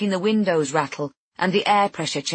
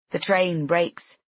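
usual speaking voice

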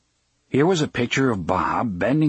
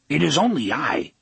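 lightly voiced little train, raised pitch; a hint of small gruff engine; 4 semitones higher than usual. A narrator's reading. Hidden train makes itself known, lightly gruff small voice. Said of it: "gruff voice".

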